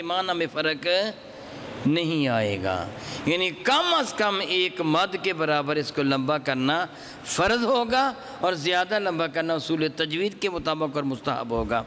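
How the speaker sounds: ordinary talk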